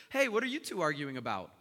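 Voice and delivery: loud, cheery interruption; brash interjection from a stranger; barging in. Acted. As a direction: butt loudly into someone else's conversation. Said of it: "this crazy guy decides to just barge in".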